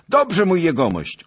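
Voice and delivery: high, loud shouted assent; called reply. A narrator's reading. Squire answering his master on horseback, high eager shout. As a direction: call back promptly over the gallop.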